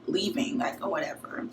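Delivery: fading down to a whisper; muttered aside that fades to nothing; trailing off to a whisper. Retold or imitated. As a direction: let it die away under your breath. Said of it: "So I'm like".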